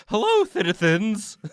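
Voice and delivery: high, hammy royal greeting; booming king voice. Pretend. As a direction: call out like a jolly NPC king. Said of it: "he should talk like".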